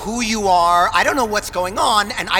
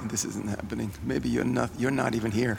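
muttering in denial; 8 semitones lower, 9 dB quieter, much faster than usual. Acted. Panicking, denying the whale.

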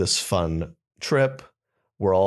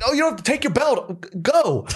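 impatient worker. Retold or imitated. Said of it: "He's like".

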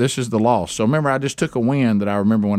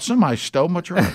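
mock panic; 7 semitones higher, faster than usual. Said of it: "And I thought".

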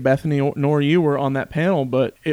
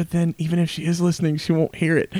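usual speaking voice

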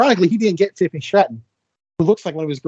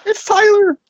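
mocking high voice; 4 dB louder, much slower than usual. Mocking the old name.

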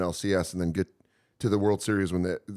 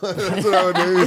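ordinary talk

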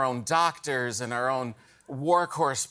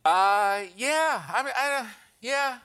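hesitant, high-pitched yeah; unconvincing excitement; 8 semitones higher, slower than usual. Acted. Guest asked about being excited for the new year, unconvinced. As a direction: stall and squeak out a doubtful yes. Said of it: "you sound excited".

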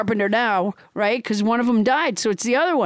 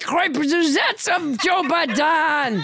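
hammed-up pompous French accent; French Trump. French Trump warns Nancy Pelosi off impeachment, mock French accent. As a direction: declare it grandly with a comic French accent. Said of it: "your extremely realistic accent".

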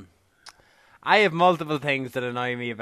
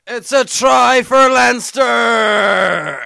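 high, very loud drawn-out sports cry; mock commentator shout; 8 semitones higher, 10 dB louder, much slower than usual. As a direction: bellow it like a hammed-up rugby commentator. Mock sports cry after accent banter.

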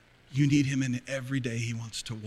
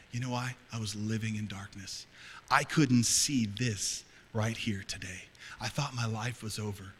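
ordinary talk

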